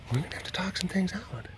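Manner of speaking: whispered, as a mock warning; whispered talk to a suspicious cat. Shown as a demonstration. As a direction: breathe it out quietly to the cat.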